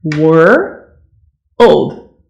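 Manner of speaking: put-on Wor- then old, broken slowly; world by parts. Shown as a demonstration. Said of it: "It's a hard one".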